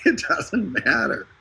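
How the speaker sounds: slowly insist, pitch lifted; slow emphatic repeat. Real, not acted. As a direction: stretch out each word for emphasis.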